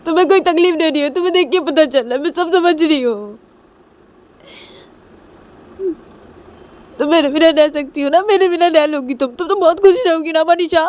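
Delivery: high, loud tearful outburst; tearful shouting. Acted. Nisha cries at Manisha about being left, tearful shout. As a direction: cry out loudly, high and upset. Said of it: "बहुत ज़ोर से चिल्ला देती है".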